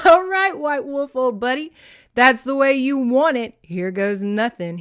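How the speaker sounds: loud, cocky whoop that starts high; crowing. Acted. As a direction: crow it out, high then dropping. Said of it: "he crowed".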